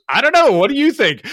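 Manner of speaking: high, loud clueless comeback; shrugging reply. Retold or imitated. Officer on the radio shrugging at the crew, high and loud. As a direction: toss it back with a shrug. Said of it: "And the S T O goes".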